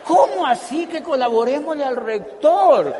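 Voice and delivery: high, loud mock-outraged question; loud, incredulous retort; indignant disbelief. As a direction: throw the words back in disbelief. Indignant professor quoted.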